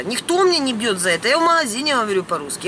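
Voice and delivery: normal talking voice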